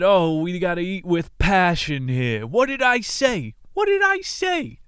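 shrieking Italian mother scolding at the table. Acted. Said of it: "into their Italian".

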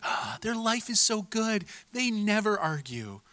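high, slow, wistful sigh of envy; dreamy admirer. Pretend. Viewer sighing over a friend's perfect posts, high and slow. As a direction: gush like a dazzled follower. Envious onlookers voiced.

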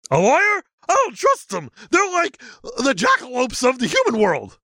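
high dopey creature voice; goofy high-pitched monster; Sasquatch voice. Acted. Sasquatch character voice.

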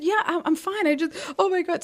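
high, choked-up reassurance; tearful. Retold or imitated. Answering the worried man beside her while tearing up. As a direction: say you're fine while on the verge of tears. And I start crying.

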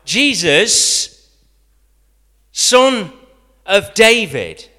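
loud, high, drawn-out cry; shouting. A narrator's reading. Beggar shouting out to Jesus by his royal title. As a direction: call out with everything. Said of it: "shouts out".

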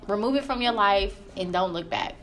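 normal talking voice

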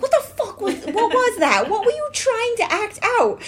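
high, loud, incredulous charade yell; mock exasperation. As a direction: blow up at a teammate's bad acting. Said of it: "And you're like".

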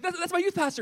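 imitating a flustered teen, high-pitched; mock panic, stammered high; freaking out. Freshman tells her friends who he is, freaking out. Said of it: "she's kind of freaking out".

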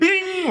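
high bright bing; ding like a warning bell; mock alert chime. Imitated alert sound.

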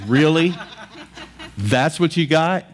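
amused disbelief. Retold or imitated. Preacher retelling how he scoffed at a night intruder, amused disbelief. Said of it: "I just laughed".

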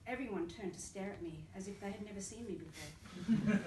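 usual speaking voice

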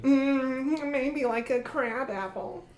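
high, soft little creature; timid creature muses quietly; Gerby voice. Acted. Gerby answering the question.

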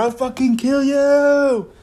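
high, slow, put-on menacing snarl; mock murderous threat. Retelling Mari's threat to Kaz, slow put-on menace. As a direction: growl the threat like an enraged inmate.

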